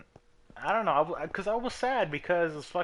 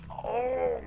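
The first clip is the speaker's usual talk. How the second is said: covetous cry; 12 semitones higher than usual. Real, not acted. Gasping over coveted find.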